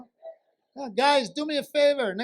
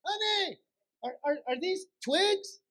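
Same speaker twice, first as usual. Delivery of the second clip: high-pitched, exasperated yelling; acted outraged yell across a store; yelling to wife; 8 semitones higher, 5 dB louder than usual. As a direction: holler for your honey in high-pitched disbelief. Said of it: "And I'm yelling".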